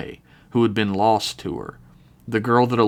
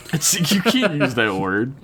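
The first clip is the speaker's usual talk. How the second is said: laughing; 5 semitones higher than usual. Real, not acted. Laughing at a line.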